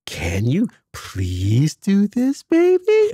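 daughter parrots dad's gentle ask in a high, stretched voice; mimicking dad. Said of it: "says it in the way that I would say it".

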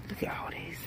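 breathy whispered aside. Real, not acted. Shopper whispering to viewers while hunting clearance in the store. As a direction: whisper it so nobody hears.

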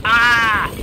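high, loud scream; short playful battle scream; attack yell. Pretend. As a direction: scream as you hurl yourself at it. Attacking the building.

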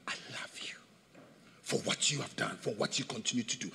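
hushed unvoiced whisper of love; tender whispered voice of God. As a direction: breathe the words close to the ear. God speaks softly to you.

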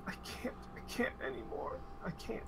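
high, soft, falling apart; barely manage the words; broken high whimper. Acted. NPC breaking, weeping.

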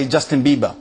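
ordinary talk, nothing marked

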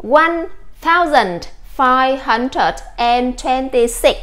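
very slow, every word spaced out; slow model reading. Shown as a demonstration. Teacher reading a long number slowly to students, very slow. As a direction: read it word by word for the class to copy. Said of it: "Chúng ta sẽ cố gắng đọc nào".

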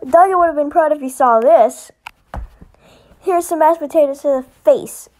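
loud battle taunts; a loud gloating attack cry; triumphant yelling. Acted. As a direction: shout it in the heat of the fight.

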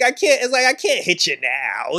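normal talking voice

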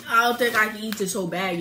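ordinary talk

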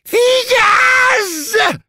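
extremely high, long screamed command; piercing drill-sergeant howl; horrific scream. A narrator's reading. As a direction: shriek it as if hit by a shell. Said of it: "olyan rémületes férfi sikolyjal".

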